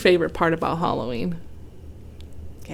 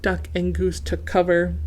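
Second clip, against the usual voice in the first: plain reading voice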